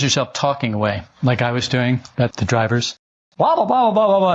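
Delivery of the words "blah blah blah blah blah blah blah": mock rant. Mocking his own ranting.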